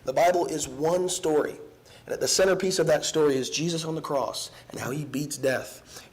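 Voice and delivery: ordinary delivery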